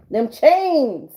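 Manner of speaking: high, loud triumphant shout; gleeful cheer. Real, not acted. Reader gleefully shouts her catchphrase to the viewer, loud and high. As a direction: cheer it like breaking free.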